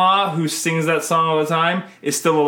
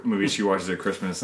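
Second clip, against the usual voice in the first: ordinary talk, nothing marked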